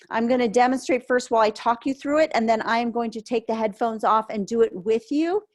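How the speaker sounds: ordinary delivery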